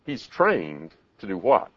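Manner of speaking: ordinary delivery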